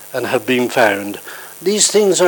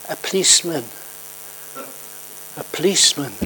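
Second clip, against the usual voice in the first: put-on shy little girl; 7 dB quieter, faster than usual. A narrator's reading. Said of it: "a very shy little girl".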